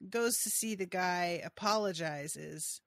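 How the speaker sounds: ordinary talk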